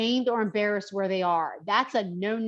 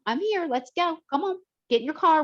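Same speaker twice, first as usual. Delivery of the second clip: higher-pitched, hurrying encouragement; urging coax; 6 semitones higher than usual. Retold or imitated. Trainer on the phone getting a client moving, urging coax. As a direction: coax them along, picking up speed.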